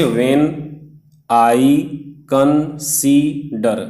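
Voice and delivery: "when I consider" slowly, each syllable set apart. Shown as a demonstration. Teacher counting five syllables for students, syllable by syllable. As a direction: pause after when, after I, split consider in three. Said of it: "यहाँ तक कितने हो गए".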